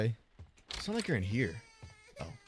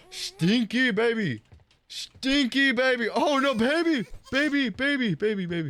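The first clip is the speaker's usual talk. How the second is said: put-on loud, high sing-out to a baby; playful baby talk; 9 semitones higher, 3 dB louder than usual. Babysitter fussing over a stinky baby, playful high baby talk.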